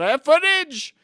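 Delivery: high, loud protest; defiant high holler; indignant shout. Retold or imitated. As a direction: yell it high, as proof against a liar. Retort to a rival's lies.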